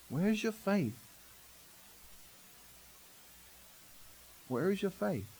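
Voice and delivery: soft, quiet question; gentle rebuke. Retold or imitated. Jesus quietly asking his disciples about their faith. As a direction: ask it softly, almost sadly.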